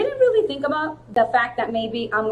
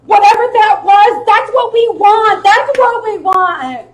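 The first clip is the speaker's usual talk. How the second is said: high, loud sideline yell imitation; shouting coach; 11 semitones higher, 12 dB louder, slower than usual. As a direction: holler like a coach cheering on a fighter.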